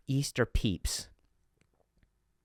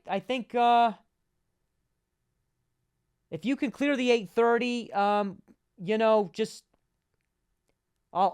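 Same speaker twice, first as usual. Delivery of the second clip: chirpy high-pitched receptionist voice, louder; high customer service voice; 12 semitones higher, 5 dB louder than usual. Retold or imitated. On the phone to the massage client, bright high service voice. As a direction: play the eager front-desk guy, pitched way up.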